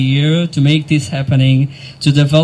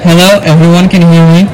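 calling out to crowd; 9 dB louder, much faster than usual. Real, not acted.

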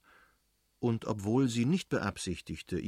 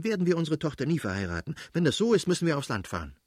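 high, hurried, exasperated retort; aggrieved wife's outburst; interrupting; 6 semitones higher, 4 dB louder, faster than usual. A narrator's reading. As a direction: cut in and argue back quickly. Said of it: "unterbrach die Fürstin".

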